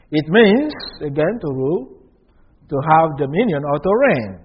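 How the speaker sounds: ordinary talk, nothing marked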